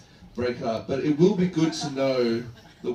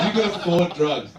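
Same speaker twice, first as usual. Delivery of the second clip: laughing repeat; 4 semitones higher, 7 dB louder than usual. Acted.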